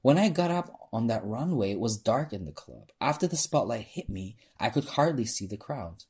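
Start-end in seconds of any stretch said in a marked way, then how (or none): none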